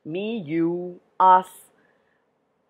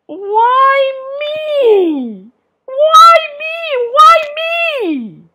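high, loud drawn-out whine; whining; 11 dB louder, much slower than usual. A narrator's reading. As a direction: wail it like a self-pitying whiner.

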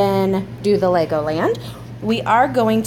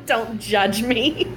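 laughing; much slower than usual. Retold or imitated. Mom echoes child, laughing.

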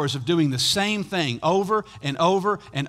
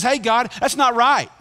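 raised voice, higher and louder; indignant protest; 6 semitones higher, 6 dB louder than usual. Acted. Nehemiah seeing enemies coming against him, loud protest to God. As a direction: call out a complaint to God. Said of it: "and he says".

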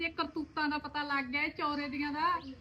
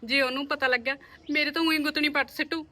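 fearful pleading; 8 dB louder than usual. Acted. Scared wife fears husband finding out.

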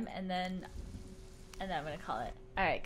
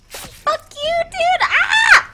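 much louder, much higher outburst ending in a scream; furious yell; 13 dB louder, much slower than usual. Real, not acted. Streamer raging at an enemy, yelling then screaming. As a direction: let it rip at the enemy. Attacked in game.

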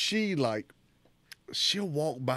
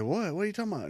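higher-pitched innocent protest; feigned innocence; 9 semitones higher, much faster than usual. Retold or imitated. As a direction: play dumb when caught, imitating her. Caught coworker feigns innocence.

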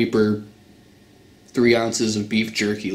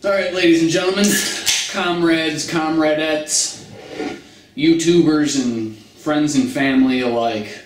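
hype announcer; 4 semitones higher than usual. Acted. Hyped intro to his viewers.

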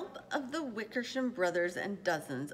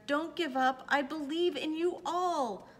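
raised, urgent rallying call; calling encouragement; 7 semitones higher than usual. A narrator's reading. Horton being hauled off, calling to the mayor to hold on. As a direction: call out high, urging them on. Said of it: "he managed to call".

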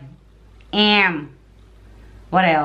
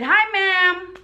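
retold greeting; 8 semitones higher than usual. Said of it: "and I said".

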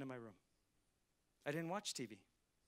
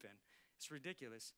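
usual speaking voice

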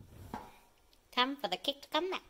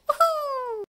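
excited cheer; 13 semitones higher, 7 dB louder than usual. Real, not acted. Mural complete.

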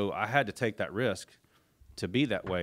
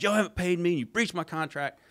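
retold yelling; 9 semitones higher, 4 dB louder than usual. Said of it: "he starts yelling at her".

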